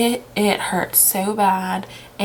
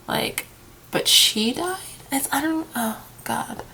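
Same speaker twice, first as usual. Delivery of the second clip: voice breaking; 6 semitones higher, 4 dB quieter, slower than usual. Real, not acted. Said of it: "I'm trying not to cry".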